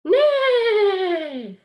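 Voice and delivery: high, loud whinnying neigh; whinny like a horse. Shown as a demonstration. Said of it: "Okay, make this sound".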